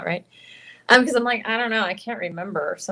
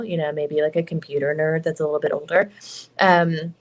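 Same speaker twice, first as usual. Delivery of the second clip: normal talking voice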